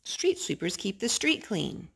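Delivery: plain reading voice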